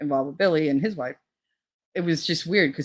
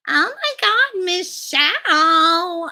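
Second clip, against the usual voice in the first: high, loud mock gushing, last word drawn out; fake friendly greeting; 14 semitones higher, 5 dB louder, much slower than usual. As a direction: squeal a phony, over-delighted hello. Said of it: "like, fake thing back and forth".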